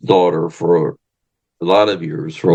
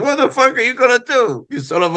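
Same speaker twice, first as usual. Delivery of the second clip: much higher mock Freddie voice, laughing it off; Freddie scoffing. Wrestler Freddie mocking his escort, in a high amused voice. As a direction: throw it back at him, incredulous and amused.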